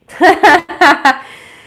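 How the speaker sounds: sudden loud laugh; burst of laughter. Real, not acted. Laughs after joking about bills.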